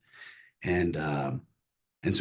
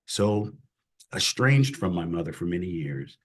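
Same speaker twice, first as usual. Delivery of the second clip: ordinary talk, nothing marked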